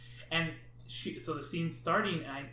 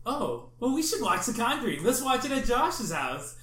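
higher, louder, teasing eagerness, the last line slowed; mock eager invitation; 7 semitones higher, 6 dB louder than usual. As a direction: sell the movie with fake innocent enthusiasm. Friends luring Ian to watch.

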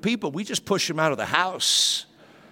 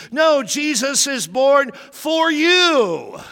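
slow, higher, louder insistence; emphatic slow declaration; 9 semitones higher, 5 dB louder, much slower than usual. Real, not acted. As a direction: drive it home slowly. Emphatic preaching correction.

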